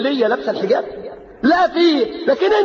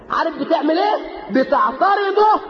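preaching shout; 7 semitones higher than usual. Real, not acted. Preacher rebuking a father who forbids hijab, shouting. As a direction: thunder the question at the father.

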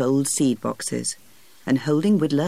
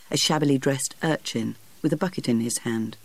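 ordinary delivery

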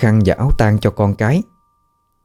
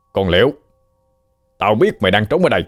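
thug barking orders, rising higher at the end; angry shout; 4 semitones higher, faster than usual. A narrator's reading. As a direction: yell orders to drag her out. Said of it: "hắn quát lớn". (tên Trọc quát lớn ra lệnh lục soát)

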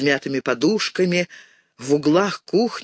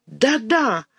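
higher, angry shout with a hint of tears; girl accusing the icon, tearful and higher; tearful angry cry; 7 semitones higher than usual. A narrator's reading. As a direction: blame the icon through tears. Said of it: "со слезами и гневом кричала мышка".

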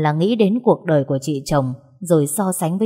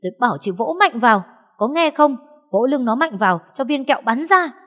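impatient yell; 7 semitones higher than usual. Acted. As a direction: yell at her to hit harder, fast and high. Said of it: "tôi sốt ruột hét ầm ĩ". (hét sốt ruột, nhanh, cao giọng)

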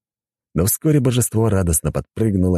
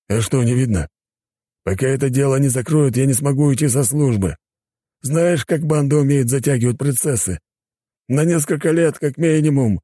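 higher-pitched, lamenting policeman; despairing inspector; 10 semitones higher than usual. Acted. Inspector facing endless investigation before retirement, despairing lament.

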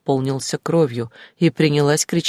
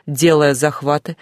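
ordinary delivery, nothing marked

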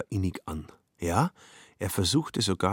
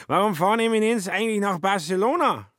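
put-on drunk Schmitz; 12 semitones higher, 4 dB louder than usual. Drunk Schmitz questions Kobler.

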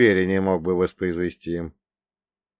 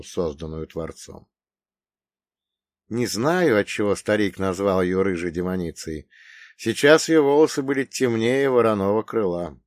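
ordinary delivery

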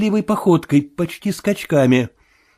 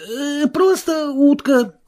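high, slow, hesitant denial; nervous Marvel; 9 semitones higher, slower than usual. A narrator's reading. Marvel brushing off the sailor, nervous. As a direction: insist haltingly in a high, thin voice.

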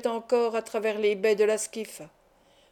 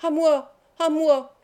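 higher, louder repeated call for help; cry for help; 5 semitones higher, 5 dB louder, slower than usual. A narrator's reading. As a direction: call out urgently for rescue. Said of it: "s'écria Saba". (appel au secours, crié)

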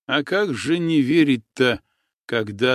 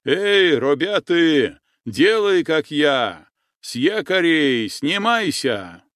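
shouting into the dark; 4 semitones higher, slower than usual. Acted. Boatman shouting orders into the darkness to his crew. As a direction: bellow the order to the men. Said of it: "закричал в темноту".

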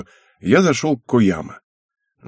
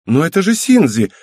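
put-on high, louder girlish outburst; excited teenage-girl protest; exclaiming; 7 semitones higher, 5 dB louder than usual. A narrator's reading. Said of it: "воскликнула она".

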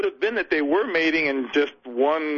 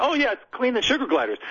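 carefree self-quote; 9 semitones higher than usual. Retold or imitated. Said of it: "and I said".

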